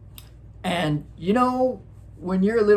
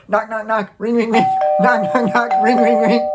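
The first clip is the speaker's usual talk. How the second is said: frantic knocking sounds; 6 semitones higher, 7 dB louder than usual. Retold or imitated.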